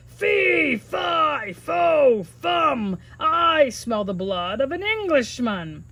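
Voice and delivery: loud shouted giant chant; giant yelling. Acted. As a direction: bellow it like a hungry giant. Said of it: "he thundered".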